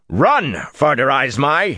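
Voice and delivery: high, loud, sharp command; snapped order. A narrator's reading. Rand hurrying the servant away, loud snapped order. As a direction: snap it out to hurry them. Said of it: "he snapped".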